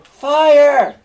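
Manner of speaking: a full-voiced shout of Fire. Shown as a demonstration. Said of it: "what I want you to do is, is say".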